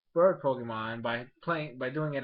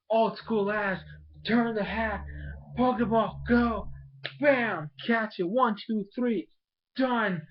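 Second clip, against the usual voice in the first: high-pitched, punchy climax; excited play-by-play; 8 semitones higher, 3 dB louder, slower than usual. Retold or imitated. Fan recapping Ash catching Fletchling, excited play-by-play. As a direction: call each beat like a winning move.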